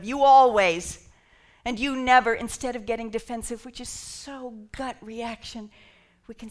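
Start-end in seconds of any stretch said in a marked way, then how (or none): 0.0-1.1 loud, drawn-out mock accusation; exaggerated accusation. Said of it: "those exaggerated claims of". 1.8-2.3 loud mock accusation, pitched up; exaggerated accusation. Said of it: "those exaggerated claims of".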